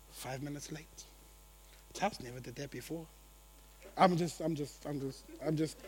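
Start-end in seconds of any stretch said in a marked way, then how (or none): 0.1-3.1 judgmental murmur. Retold or imitated. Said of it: "we're always like".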